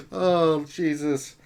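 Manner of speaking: ordinary talk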